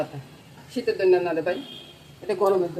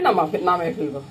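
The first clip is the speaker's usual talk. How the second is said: ordinary talk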